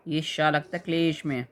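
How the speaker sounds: usual speaking voice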